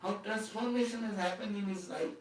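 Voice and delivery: usual speaking voice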